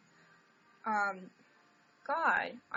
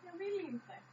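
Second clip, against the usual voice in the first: voicing the cat; 7 semitones higher, 7 dB quieter than usual. Voicing the cat's refusal.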